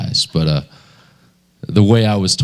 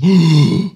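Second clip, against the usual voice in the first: loud, sharp intake of breath; revived woman gasping for air; big gasp; 7 semitones higher, 5 dB louder than usual. Retold or imitated. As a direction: gasp like coming back to life. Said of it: "and she gasped".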